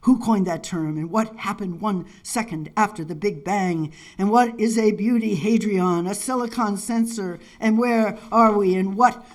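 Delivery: declaimed list-poem, question after question; poetry reading. Acted. Poet reading questions for citizens aloud, insistent declamation. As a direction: read it as an incantatory, insistent run of questions.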